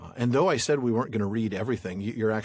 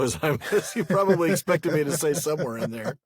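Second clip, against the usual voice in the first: raised, loud voice breaking into laughter; laughing; 6 semitones higher, 5 dB louder than usual. Real, not acted. Co-host joking about the expected interjection, laughing. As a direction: talk through a real laugh.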